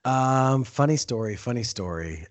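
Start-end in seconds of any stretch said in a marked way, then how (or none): 1.3-2.2 quiet repeat. Real, not acted. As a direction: lower and softer echo. Repeats phrase, then drops voice.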